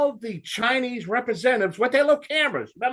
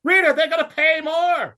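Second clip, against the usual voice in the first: rant shout; 9 semitones higher, 3 dB louder than usual. Real, not acted. Host ranting to his co-host about paying more, shouting. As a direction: pay more shouted high and loud. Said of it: "this rant".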